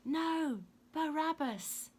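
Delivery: high, loud mob cry; crowd shout. A narrator's reading. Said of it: "the crowd shouted".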